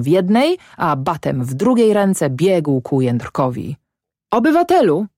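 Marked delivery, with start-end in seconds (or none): 4.3-5.1 high, loud call, falling at the end. A narrator's reading. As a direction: hail the peasant loudly. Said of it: "zawołał Panicz do Ślimaka".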